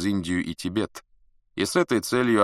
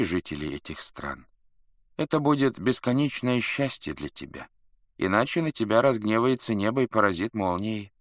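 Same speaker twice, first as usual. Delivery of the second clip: higher, priest-like solemn intonation; solemn and grave; 4 semitones higher than usual. A narrator's reading. Adviser interprets heaven's will for Chingis Khan, solemnly. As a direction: pronounce it like a priest reading a prayer. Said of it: "Елю Чуцай говорил торжественно и важно, точно жрец, читающий молитву".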